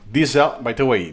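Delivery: ordinary delivery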